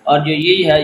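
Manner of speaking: usual speaking voice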